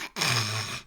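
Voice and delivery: harsh guttural throat rasp; demon-language noise. Shown as a demonstration. Demon demonstrating how demon language is pronounced, guttural rasp. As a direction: rasp out a wordless demonic growl. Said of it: "In demon language, we only pronounce".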